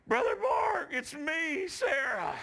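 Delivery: put-on high, loud girl's call; high-pitched shout of recognition; imitated excited greeting. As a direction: call out joyfully as the girl. Voices girl calling out a greeting.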